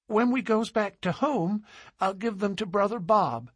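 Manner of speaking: put-on high, eager little-girl voice; a delighted small girl showing treasures; enthusiastic child. A narrator's reading. Little girl shows the man her mica, high and eager.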